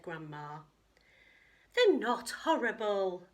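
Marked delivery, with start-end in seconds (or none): 1.7-3.3 sniffy. Acted. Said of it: "sniffed Katie".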